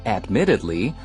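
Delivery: ordinary delivery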